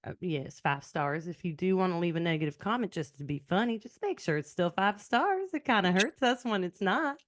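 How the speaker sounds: easy Southern sign-off; Southern accent. Shown as a demonstration. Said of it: "in your Southern accent, accent, why don't you sign us off?".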